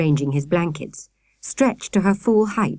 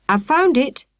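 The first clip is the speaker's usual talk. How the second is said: triumphant shout; 8 semitones higher than usual. A narrator's reading. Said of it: "Akela shouted triumphantly".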